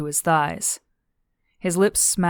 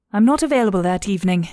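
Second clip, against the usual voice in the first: brisk, quick, louder brush-off; lightly voiced Zandy; 3 semitones higher, 6 dB louder, faster than usual. A narrator's reading. Zandy turning down Daniel's arrangements, brisk character voice. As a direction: turn them down briskly in character.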